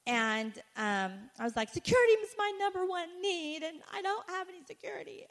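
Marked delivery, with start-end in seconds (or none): 1.7-5.2 whiny complaint to God. Retold or imitated. Retells her whining prayer to God.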